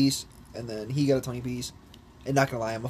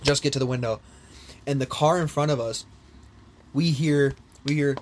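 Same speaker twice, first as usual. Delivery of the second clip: ordinary talk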